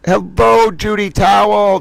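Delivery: bright, loud hello to a viewer; excited greeting. Real, not acted. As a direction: call out a happy welcome.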